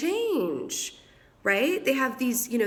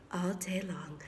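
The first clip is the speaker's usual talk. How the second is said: much softer, breath-only whisper; whispered aside; 2 semitones lower, 9 dB quieter, slower than usual. Real, not acted. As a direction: drop to a confiding whisper. Playful aside about endless talk.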